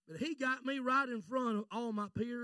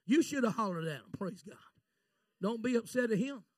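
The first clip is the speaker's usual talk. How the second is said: ordinary talk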